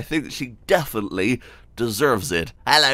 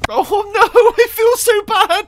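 laughing; 9 dB louder, slower than usual. Real, not acted. After hurting the dog.

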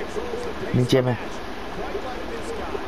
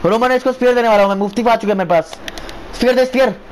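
excited shout; 5 semitones higher than usual. Real, not acted. Hyped up during big move.

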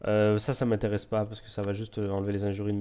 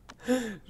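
short high laugh; 12 semitones higher than usual. Real, not acted. Laughs at own question.

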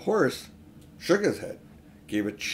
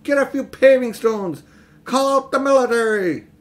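much higher, loud mob shouting; angry crowd roar; 11 semitones higher, 8 dB louder than usual. A narrator's reading. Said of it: "an angry roar from the crowd".

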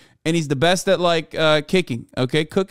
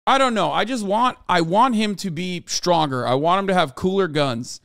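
usual speaking voice

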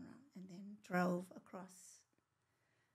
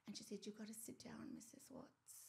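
hushed whisper at the door; 12 dB quieter than usual. Real, not acted. Retelling the police at the door, whispered. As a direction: whisper it, tense and soft.

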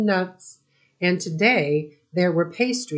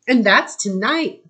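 higher, a bit louder, thrilled; girl cries out that it's tonight, eager and higher; excited cry; 5 semitones higher, 5 dB louder than usual. A narrator's reading. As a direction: burst out with the good news. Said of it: "cried Layla".

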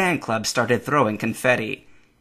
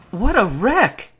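high, drawn-out shock; shocked exclamation; 10 semitones higher, 5 dB louder, slower than usual. A narrator's reading. Misty sees the truck pileup, exclaiming in shock. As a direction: exclaim slowly, high and loud. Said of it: "Misty exclaimed in shock".

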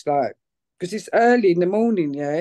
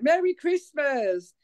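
mild retold shout; 6 semitones higher, slower than usual. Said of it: "I shout out".

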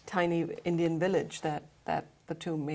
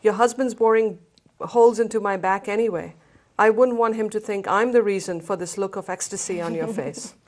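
louder, playful teasing as Richard; 4 semitones higher, 11 dB louder than usual. Acted. Richard ribbing Ellie at the village feast, playful louder teasing. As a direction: tease her about the jealous husband.

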